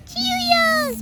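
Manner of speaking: put-on high, babyish little voice. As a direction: say it like a toddler. Pretend baby says the word.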